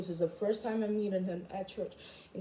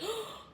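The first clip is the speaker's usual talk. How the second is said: mock gasp; 13 semitones higher, 9 dB quieter than usual. Mocking imagined viewer.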